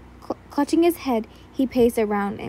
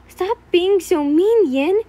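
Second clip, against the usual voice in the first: menacing plea; 5 semitones higher, 5 dB louder, slower than usual. A narrator's reading. Holding her down.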